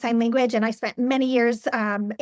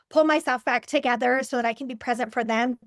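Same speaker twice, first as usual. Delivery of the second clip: usual speaking voice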